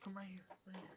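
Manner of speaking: hushed whisper to a pet; whispering to a dog. Real, not acted.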